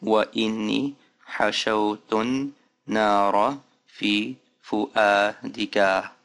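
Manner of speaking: Arabic line read fast with vowels kept. Shown as a demonstration. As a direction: speed up the recitation. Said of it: "just a little bit faster".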